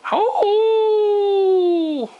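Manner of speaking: high howling wolf cry. Pretend. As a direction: howl like a wolf. Wolf howls.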